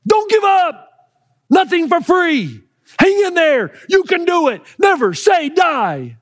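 high, strained shouts of encouragement; desperate yelling. Retold or imitated. Father screaming at his son not to get pinned, desperate yelling. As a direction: yell from the top row like your son's life depends on it. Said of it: "Dane starts yelling".

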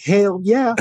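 a quick private whoop of excitement; inner hell yeah. Retold or imitated. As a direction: cheer inside your own head. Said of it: "in my head, I'm like".

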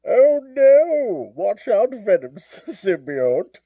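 mock-alarmed villain voice; hammed-up masked villain crying a warning; Bane. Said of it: "do Bane doing the Cookie Monster".

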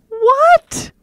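very high, loud rising what; both of you floored; stunned. Retold or imitated. Both react to the test.

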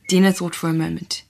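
ordinary delivery, nothing marked

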